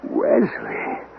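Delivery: the name drains out of him in shock; stunned whisper. Acted. Master hears a dead man's name, whispers it aghast.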